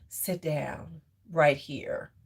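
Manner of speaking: low, slow, soft firm order; slow low dominant instruction; firm slow command. Shown as a demonstration. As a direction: command them slowly and quietly. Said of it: "That's how you talk".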